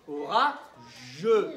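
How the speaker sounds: "Aura", pause, then a loud "je" with its vowel; full final syllable. Shown as a demonstration. Teacher contrasting the wanted syllable, final vowel stressed. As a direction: detach "je" and sound the e. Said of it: "Mais moi, je veux je".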